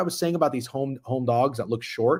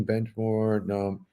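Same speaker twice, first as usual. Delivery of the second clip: ordinary delivery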